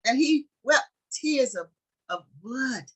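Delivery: stirring sermon climax; fervent preaching. Acted. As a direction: preach it up to the congregation. Preacher building to full force.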